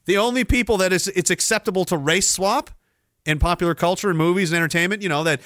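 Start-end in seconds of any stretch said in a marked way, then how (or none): none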